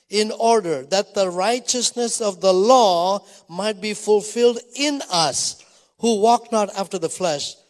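measured pulpit reading; scripture reading. Acted. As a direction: read the passage like a sermon, building. Preacher reads Romans eight aloud.

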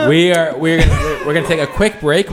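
laughing while talking. Real, not acted. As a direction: talk through a laugh. Cracking up after ASMR joke.

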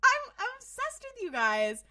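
high, loud, drawn-out gush; mock-swooning adoration; gushing fan. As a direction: gush slowly over them at high pitch. Gushing mock reaction to the characters.

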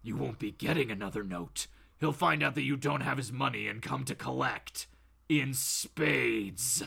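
low, quiet menace; deep, softly delivered threat; Batman. Acted. The vigilante warning Bob about the money, low and menacing. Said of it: "And Batman goes".

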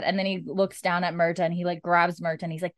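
ordinary talk, nothing marked

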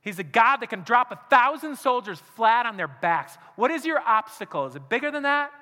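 loud, high, impassioned altar-call preaching; fiery sermon climax, voice ringing out; preaching at full force. Acted. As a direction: drive it home to the congregation. Challenge to the congregation.

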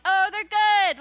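fake polite praise. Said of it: "we're like".

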